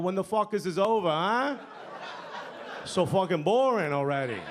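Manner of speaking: mild mock yell from the back; retold uncle's yell. Uncle heckling at a family funeral, mock yell. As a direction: complain like an impatient Jersey uncle. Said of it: "you hear our uncles yell".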